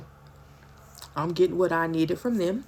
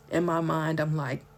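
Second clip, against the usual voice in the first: usual speaking voice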